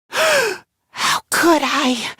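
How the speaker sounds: slow, gasping self-reproach; gasp, then draw the words out in dismay; horrified gasp. Acted. Lowly maggot shocked at thinking badly, horrified.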